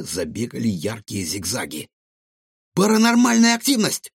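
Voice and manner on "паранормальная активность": very high, loud squeaky exclamation; shrieked announcement from a small creature; excited cry. Acted. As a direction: cry out the alarming discovery. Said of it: "возбуждённо вскрикнул Гаврош".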